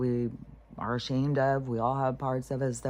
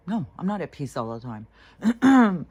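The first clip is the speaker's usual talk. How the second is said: loud, higher-pitched blunt rebuttal; emphatic denial; 4 semitones higher, 8 dB louder than usual. Real, not acted. Speaker rejecting the act of constant peace, emphatic denial. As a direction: burst out of the hush and push back hard.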